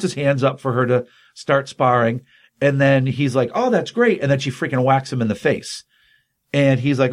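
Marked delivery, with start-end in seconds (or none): 3.5-4.2 high-pitched mock enthusiasm; mock delight. Acted quote of pleased sparring partner.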